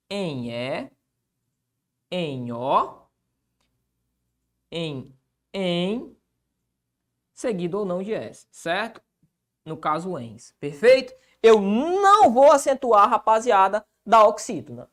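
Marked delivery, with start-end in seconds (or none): 0.1-6.1 soft, low endings, spaced far apart; dictating. Real, not acted. Teacher writing the list of endings, quiet dictation. 11.4-13.1 loud, high, stretched "não"; emphatic NÃO. Real, not acted. Teacher driving the rule home, heavily stressed "não".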